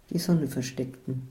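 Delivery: plain reading voice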